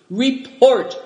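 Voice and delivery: short loud high command; curt order. A narrator's reading. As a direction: bark it like a robber chief. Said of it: "ordered Dampignac".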